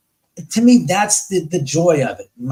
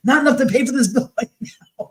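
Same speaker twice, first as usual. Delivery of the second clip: laughing; 8 semitones higher than usual. Real, not acted. Joke lands, laughing mid-line.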